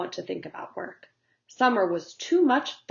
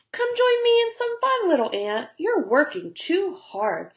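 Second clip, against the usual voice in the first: high, louder playful call; cheerful high invitation; coaxing grasshopper; 10 semitones higher, 5 dB louder than usual. Acted. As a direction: tempt the little ant to play. Grasshopper calls the ant.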